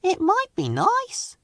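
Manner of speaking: soft, high, gentle comfort; softly reassuring, slow. Acted. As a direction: comfort a nervous friend. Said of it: "said Thomas reassuringly".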